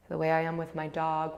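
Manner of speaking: ordinary delivery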